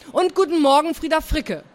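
single out the late pupil; pointed teacher greeting. A narrator's reading.

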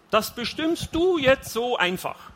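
high, slow, stressed indignation; indignant protest. A narrator's reading. Husband challenges wife's decision, indignant. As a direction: spell out your outrage slowly.

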